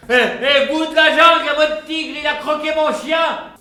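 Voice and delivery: loud, high outraged complaint; indignant shout. Acted. Neighbour shouting that the tiger ate his dog. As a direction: yell it, furious the dog got eaten.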